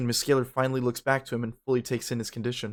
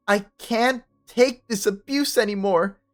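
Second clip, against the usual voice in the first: furious outburst; 10 semitones higher, 6 dB louder than usual. Acted. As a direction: pour out years of anger. Mia's outburst at Ben.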